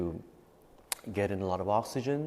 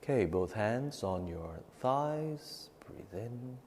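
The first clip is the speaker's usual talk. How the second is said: guided breathing meditation; 11 dB quieter than usual. Acted. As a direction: calmly guide each breath, fading to near whisper. Leads nine-round breathing meditation.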